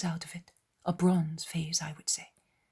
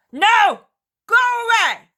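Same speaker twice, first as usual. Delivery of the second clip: high, very loud furious screaming; raging curse; 16 dB louder than usual. Acted. Cretan screaming curses at the Israelites. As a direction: spit curses at them at full volume.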